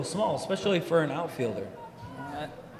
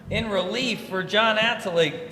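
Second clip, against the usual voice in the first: ordinary talk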